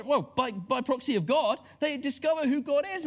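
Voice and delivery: ordinary talk, nothing marked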